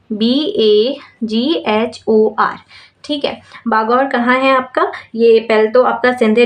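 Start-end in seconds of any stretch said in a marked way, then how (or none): 0.1-2.6 very slow, letter by letter; spelling aloud. Shown as a demonstration. Teacher spelling Bagor for students, slow and letter by letter. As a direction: spell each letter out slowly for the class. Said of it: "इसकी spelling है आपकी".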